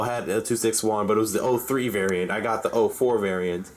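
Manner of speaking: usual speaking voice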